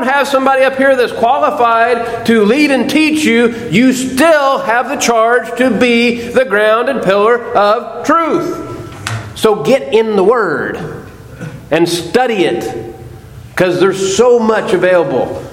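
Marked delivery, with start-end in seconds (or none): none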